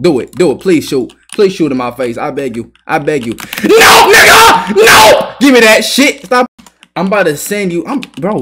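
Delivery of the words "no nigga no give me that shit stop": high furious shout of no; outraged yelling at a player; angry yell. Real, not acted. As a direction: scream him off the court. Player yelling at intruder on court.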